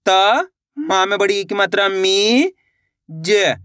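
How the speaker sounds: slow spelling-out, त and मी held and rising; syllable by syllable. Shown as a demonstration. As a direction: sound out त, then chant out the vowel sign to a long मी. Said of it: "इस तरीके से एक अक्षर का उच्चारण".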